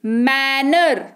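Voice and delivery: Manner drawn out slowly; slow model word. Shown as a demonstration. Teacher introduces manner to students, slow and careful. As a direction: say manner slowly, each syllable clear. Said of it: "teacher models word".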